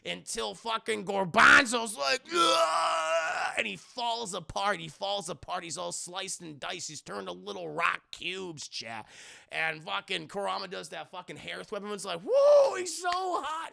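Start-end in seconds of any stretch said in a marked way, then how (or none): 2.3-3.5 high, loud mock death yell; drawn-out hammed-up scream of agony; death cry. Imitates villain being sliced apart. 12.2-13.7 very high mock fangirl squeal; hammed-up high-pitched swoon. Imitates everyone swooning over fighter.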